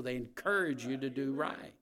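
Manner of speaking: ordinary delivery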